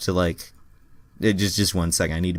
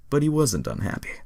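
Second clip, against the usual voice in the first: ordinary delivery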